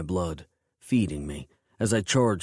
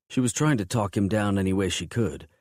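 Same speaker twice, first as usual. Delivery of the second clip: usual speaking voice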